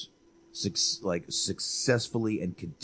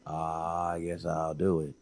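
low, soft, unbothered grumble; half-hearted resigned mutter; Seagal; 3 semitones lower, 5 dB quieter than usual. Retold or imitated. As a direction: shrug it off, lower and quieter. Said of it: "Seagal was like".

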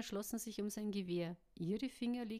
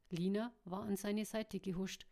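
ordinary delivery, nothing marked

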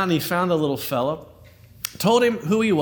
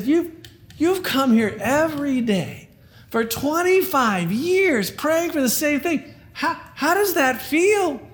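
amazed; 9 semitones higher than usual. Acted. Astonished journalist in joke.

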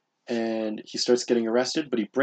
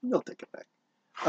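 ominous tease; 13 semitones higher, 8 dB quieter, slower than usual. Pretend. Teasing threat, high slow voice.

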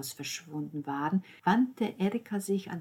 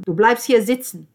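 very loud, raised command; stern order; 5 semitones higher, 14 dB louder than usual. Acted. Ordering Lucia to stay at the table, stern. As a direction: forbid it forcefully.

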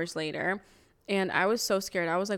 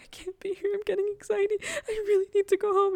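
much higher, crying, panicky plea; imitating crying; 13 semitones higher, faster than usual. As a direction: mimic a sobbing, panicked friend. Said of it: "my friend started crying".

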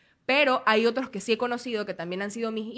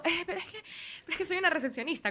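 timid protest; 7 semitones higher, 5 dB quieter, faster than usual. Retold or imitated. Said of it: "Y yo".

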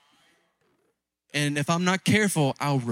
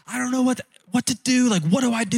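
put-on higher, pleading young man's voice; eager young convert; 7 semitones higher than usual. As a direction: voice the fired-up kid begging for help. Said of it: "and he's like".

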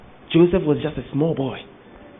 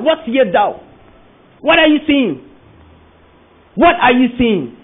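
emphatic preaching; 6 semitones higher, 4 dB louder than usual. Real, not acted. Preacher voicing God's question.

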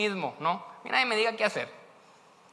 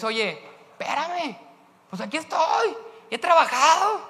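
high, louder protest of hard work; mock indignant; 9 semitones higher, 5 dB louder, slower than usual. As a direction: demand credit for your effort. Listener voiced as resentful son.

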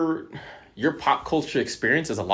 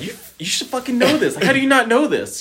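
high, incredulous frustration; mock-exasperated at a friend; 14 semitones higher, 5 dB louder than usual. Telling a friend he should know this, high and exasperated.

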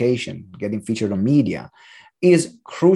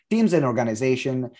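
ordinary talk, nothing marked

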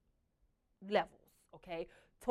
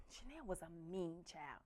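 ordinary talk, nothing marked

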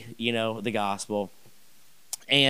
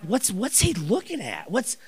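puzzled; 9 semitones higher than usual. Retold or imitated. At dying Larry's bedside, baffled and high. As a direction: wonder what on earth he's doing.

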